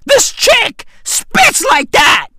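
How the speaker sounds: high shouted disbelief, slowing at the end; stunned fan yelling at the speakers; amazed outburst. Retold or imitated. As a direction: yell it like you can't believe her bars. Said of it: "I was like".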